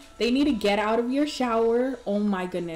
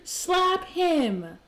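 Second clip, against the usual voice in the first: excited cheering; 9 semitones higher, much slower than usual. Real, not acted. Streamer cheering her Sim to slap the cheater. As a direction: urge her on with glee.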